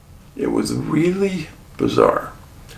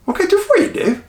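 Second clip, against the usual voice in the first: put-on friendly old man; faster than usual. Old man quoted, high.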